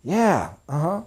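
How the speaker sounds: quiet encouraging listener noises; agreeable murmur. Shown as a demonstration. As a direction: murmur agreement to keep them saying yes. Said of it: "you have to constantly be".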